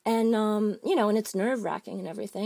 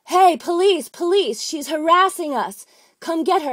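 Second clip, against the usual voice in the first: loud high yell calling for the police; retold yell; 5 semitones higher, 8 dB louder than usual. A heckler at a protest yelling for the police, loud high shout. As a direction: shout it out across the room as if calling the cops. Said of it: "Davis yells out".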